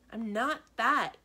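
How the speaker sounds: loud, slow protest; cries out. A narrator's reading. Kenny protesting he's not scary, loud. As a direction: cry it out in hurt denial. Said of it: "Kenny cries".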